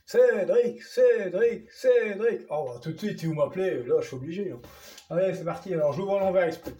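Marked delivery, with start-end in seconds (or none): 0.1-2.4 calling out higher, louder, in a singsong; playful mock call from the audience. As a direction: call the name like a viewer begging. Calling his own name teasingly.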